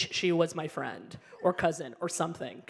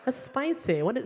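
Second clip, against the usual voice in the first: mock food taster; 6 semitones higher, slower than usual. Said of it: "Where you're like".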